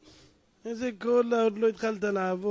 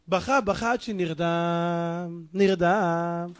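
slow, low, drawn-out words; drifting off; 6 semitones lower, much slower than usual. A narrator's reading. Storyteller lulls poor man to sleep, slow and drowsy. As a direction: stretch it out like someone sinking into sleep. Narrating crying himself to sleep.

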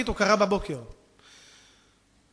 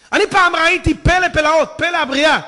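loud, high burst of awe; preach; 8 semitones higher, 12 dB louder than usual. Real, not acted. Preacher tells congregation of a wonder of creation, preaching loudly. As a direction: exclaim at full volume.

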